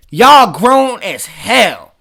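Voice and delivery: each word drawn out slowly; slow scolding. Real, not acted. Reacting to the couple on screen, slow disgusted drag. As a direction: stretch it out, disgusted.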